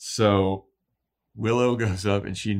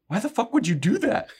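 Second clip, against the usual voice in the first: indignant; 13 semitones higher than usual. Retold or imitated. Anya outraged.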